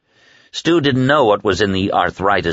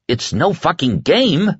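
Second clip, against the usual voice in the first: high, drawn-out cry of protest; 11 semitones higher than usual. Acted. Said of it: "Larry cried".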